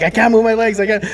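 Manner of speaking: high-pitched mock panic; retold freak-out. Patient telling the nurse about numb legs, mock panicked. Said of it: "I start freaking out".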